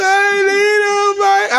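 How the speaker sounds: high slow blubbering; mock sobbing child. Father mimics his son crying over the lost dog, slow high mock sob. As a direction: sob it like a heartbroken kid.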